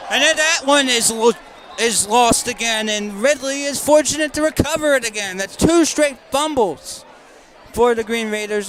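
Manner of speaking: sustained surprise at another turnover; excited fumble call. Real, not acted. Commentator to viewers as the ball is lost again, excited call.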